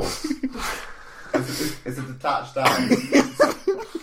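words squeezed out through laughter, high and slow; laughing. Real, not acted. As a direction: crack up mid-sentence.

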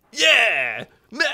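loud, high mock victory yell; celebrating cheer. Gamer retelling his squad's cheer over a destroyed tank, excited yell. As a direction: whoop it up like the squad.

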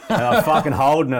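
loud and high, laughing through it. Real, not acted. Telling mates about holding the button, laughing loudly. Laughing while retelling the moment.

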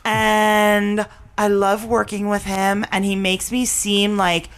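slow, affected breathy celebrity drawl. Retold or imitated. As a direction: put on a fake posh actress voice. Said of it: "and this is how she talks".

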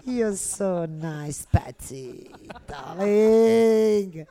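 put-on high, loud, theatrical English drunken diva; Ab Fab impression. Impersonating the Absolutely Fabulous heroines, a loud high camp diva. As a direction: camp it up like a tipsy sitcom socialite begging for a drink. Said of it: "Les Ab Fab".

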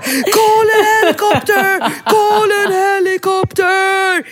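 high frantic yell, mocking her own panic; hammed-up scream for a helicopter; re-enacting her panic in a toy store; mock panicked shout. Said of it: "Bingo kan ju fortfarande mobba mig".

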